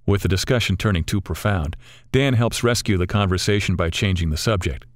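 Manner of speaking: ordinary delivery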